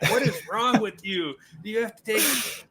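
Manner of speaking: high, exasperated teasing yell; mock disgust. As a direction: give him a hard time. Opponents yelling at him.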